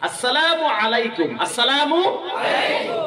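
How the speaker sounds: আসসালামু আলাইকুম drawn out slowly with the আইন from the throat; careful Arabic pronunciation. Shown as a demonstration. Preacher teaching the congregation the proper greeting, slow and exact. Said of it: "উচ্চারণ করতে কষ্ট হয়".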